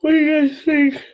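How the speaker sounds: talking through a yawn; words stretched by a yawn. Real, not acted. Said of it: "excuse my yawning".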